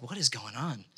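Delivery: low, hushed bewilderment; baffled muttering. Retold or imitated. Man finding nothing at the hotel, baffled muttering.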